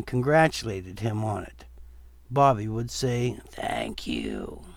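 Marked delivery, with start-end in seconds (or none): 3.5-4.6 much lower, dragged-out undead groan; low, slow zombie voice.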